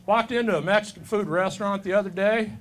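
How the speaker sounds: ordinary delivery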